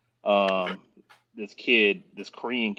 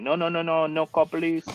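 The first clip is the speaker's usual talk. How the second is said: high panicked refusal; 8 semitones higher than usual. Retold or imitated. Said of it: "And they're like".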